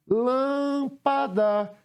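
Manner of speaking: "Lâmpada" called out high, LÂM held long; chamando a palavra. Shown as a demonstration. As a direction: stretch the first syllable of "Lâmpada" on a high, sustained note. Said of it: "Qual é a sílaba que pronuncio com maior intensidade?". ("Lâmpada" com o LÂM alongado e agudo)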